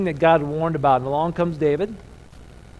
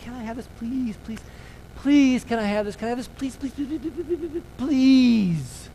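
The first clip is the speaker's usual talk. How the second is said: put-on high whiny pleading kid, climbing higher; begging child; 7 semitones higher than usual. Parent mimics child begging, high and whiny. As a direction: mimic a kid nagging for something, pitch rising. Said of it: "when your kids were asking for something".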